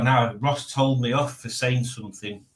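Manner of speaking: ordinary talk, nothing marked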